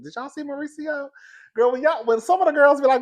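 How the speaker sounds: high, loud, gushing excitement; excited gushing. Real, not acted. Gushing to her viewers about Mauricio, high and excited. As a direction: rave about him like you can't contain it.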